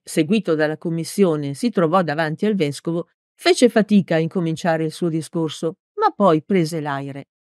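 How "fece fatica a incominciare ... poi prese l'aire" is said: ordinary delivery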